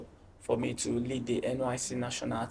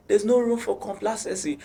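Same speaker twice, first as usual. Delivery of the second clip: high, raised-voice coach imitation; coach's shout; 8 semitones higher, 4 dB louder than usual. Coach drilling the team slogan into players, shouting. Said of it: "He will, like, shout".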